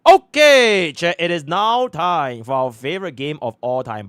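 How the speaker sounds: loud, high, slow announcer intro; game show host. Real, not acted. Introduces favorite game to chat.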